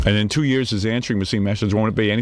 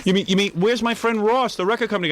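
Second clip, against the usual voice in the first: mock Gary whine; 7 semitones higher than usual. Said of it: "he had no idea".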